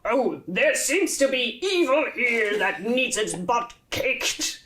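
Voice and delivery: boisterous warrior charging into the fray; hammed-up hero's battle announcement; loud, boisterous hero. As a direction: boom it out with swinging pitch and drawn emphasis. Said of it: "someone very loud and boisterous".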